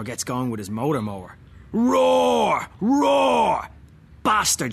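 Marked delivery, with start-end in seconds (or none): 1.7-3.7 loud, high roaring engine noise; mower roar. Retold or imitated. Imitating the motor mower. 4.2-4.7 loud, higher angry yell; Dad shouting. Retold or imitated. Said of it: "shouts Dad".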